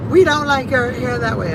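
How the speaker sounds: high, mock-whiny sisters' voice; sisters' complaint. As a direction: lightly voice the sisters nagging. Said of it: "her sisters were like".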